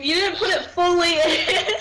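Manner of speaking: high, loud retold yell breaking into laughter; laughing mock screech; mock scream. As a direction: imitate excited screaming, laughing at the end. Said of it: "we were just screaming".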